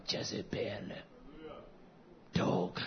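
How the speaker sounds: whispered insult; a hushed, scornful whisper. Real, not acted. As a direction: spit it out in a whisper.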